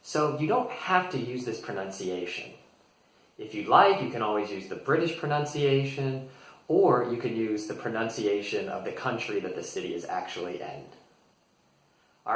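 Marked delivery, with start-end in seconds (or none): none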